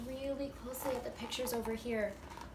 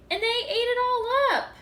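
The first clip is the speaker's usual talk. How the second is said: much higher and louder, squeaky little cub; 11 semitones higher, 12 dB louder than usual. Acted. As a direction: squeal it like an upset baby bear. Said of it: "in her high squeaky voice".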